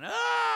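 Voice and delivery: long high loud scream; man screaming as he escapes. Retold or imitated. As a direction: scream at the top of your voice.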